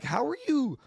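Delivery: a lightly voiced exasperated quote; mock incredulous. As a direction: squeak out the disbelief. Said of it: "I'm like".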